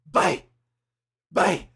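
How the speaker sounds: loud, bright call of bye bye; little girl's goodbye. Retold or imitated. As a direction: call out like the girl leaving the car. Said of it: "she's like".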